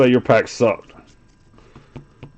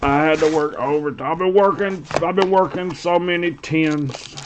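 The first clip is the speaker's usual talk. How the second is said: Irish; 3 semitones higher, 4 dB quieter than usual. Retold or imitated. Imitating a coworker griping about long shifts, whiny. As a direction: pitch it up and drag out the complaint. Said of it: "y'all should hear all the whining".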